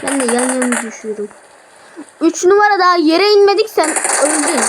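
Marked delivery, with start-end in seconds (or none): 2.2-4.6 loud and high, caught off guard; excited outburst. Real, not acted. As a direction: blurt it out in disbelief. Shot before landing, outburst.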